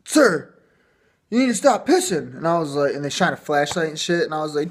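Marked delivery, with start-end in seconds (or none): 0.0-2.4 stern cop. Retold or imitated. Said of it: "and they're like".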